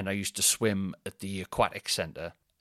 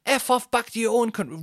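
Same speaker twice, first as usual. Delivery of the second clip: mocking, cartoonish snarl; hammed-up angry bigot; 12 semitones higher than usual. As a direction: mimic the abusive man, exaggerated. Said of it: "like that".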